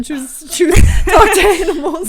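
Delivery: loud, high words through laughter; laughing, drawn-out plea. Real, not acted. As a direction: urge it while cracking up. Laughing while urging the other option.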